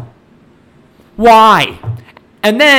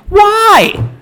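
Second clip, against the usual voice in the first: a real fed-up yell, much higher and louder; exasperated shout; 4 dB louder than usual. Real, not acted. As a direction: throw the question at the screen, yelling. Ranting about the villain.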